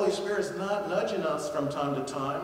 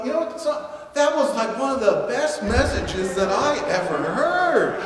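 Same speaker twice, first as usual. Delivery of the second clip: gushing praise; 4 semitones higher, 7 dB louder than usual. Pretend. Congregant praising the message.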